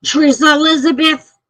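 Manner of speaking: put-on high, sing-song call of a mother summoning her child. Mother calling her daughter by full name, high call. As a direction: call her full name like a mom who means business. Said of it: "She says".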